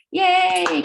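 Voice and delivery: high, loud joyful yay; excited cheer. Real, not acted. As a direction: cheer him on for the class. Cheering for departing student teacher.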